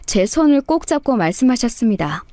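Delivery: plain reading voice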